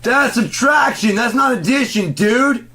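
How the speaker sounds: high, slow, shouted correction; exasperated shout, stretching each word; retold yell. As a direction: yell it at him like he's an idiot. Retold yelling at the clerk.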